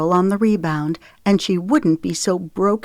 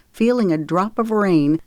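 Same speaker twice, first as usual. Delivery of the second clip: ordinary delivery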